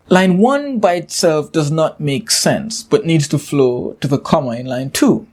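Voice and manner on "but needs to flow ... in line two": ordinary talk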